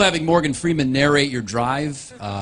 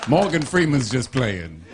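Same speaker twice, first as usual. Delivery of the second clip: Morgan Freeman; 4 semitones higher than usual. Acted. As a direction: narrate the directions like a documentary. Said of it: "it'll say things like".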